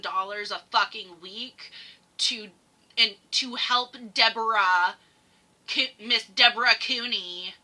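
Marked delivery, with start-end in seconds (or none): none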